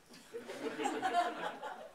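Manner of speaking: a light airy giggle; breathy laugh. Real, not acted. Narrator laughing at the memory.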